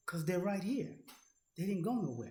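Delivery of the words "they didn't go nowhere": usual speaking voice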